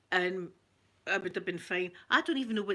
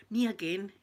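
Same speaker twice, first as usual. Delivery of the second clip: normal talking voice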